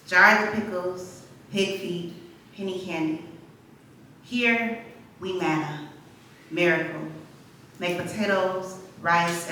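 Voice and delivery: measured poem delivery with deliberate pauses between phrases; spoken-word poem, spaced phrase by phrase; poetry reading. Acted. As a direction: recite your poem slowly to the room, letting each image land. Reads own poem aloud.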